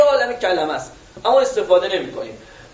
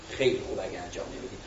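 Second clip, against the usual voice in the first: lower, much softer aside; dropping the voice; 10 semitones lower, 13 dB quieter than usual. Shown as a demonstration. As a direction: drop it low and soft. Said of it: "صداش میاره پایین".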